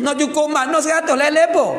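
wife answering back. Acted. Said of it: "Tanya jawab lah".

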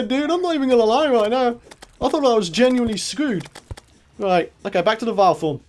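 ordinary talk, nothing marked

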